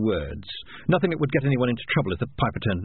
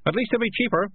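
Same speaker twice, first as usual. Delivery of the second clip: shouted from the back; 10 semitones higher than usual. A narrator's reading. Someone at the back backing the kid, calling out. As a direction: pipe up from the crowd in a high voice. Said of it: "someone at the back shouted".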